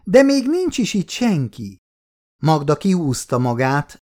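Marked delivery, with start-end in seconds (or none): 0.0-1.8 put-on high, cheeky child reply; a small girl teasing her grandma; little girl voice.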